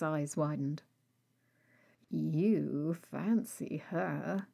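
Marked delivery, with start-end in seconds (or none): 2.1-4.5 slow, teasing and insinuating; suggestive. Acted. Rag teasing Bones about the nurse, suggestive. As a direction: drag it out to needle him. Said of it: "in as suggestive a tone as he could".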